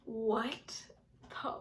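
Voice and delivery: voiceless, halting whisper; stunned whisper. Retold or imitated. Girl in the family's house, stunned whisper. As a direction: whisper it in slow disbelief.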